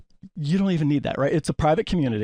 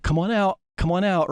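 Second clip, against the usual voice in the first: calling out; 5 semitones higher than usual. Retold or imitated. Waving, calling neighbor outside.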